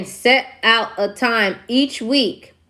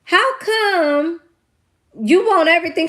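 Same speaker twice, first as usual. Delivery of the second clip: higher, put-on voice, slow on "Well, Freakazoid"; mocking Lisa voice; 7 semitones higher than usual. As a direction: tease him in a different voice. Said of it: "And she was like".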